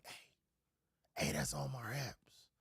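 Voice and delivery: whispered recognition. Retold or imitated. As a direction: whisper it like spotting a celebrity. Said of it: "somebody be like".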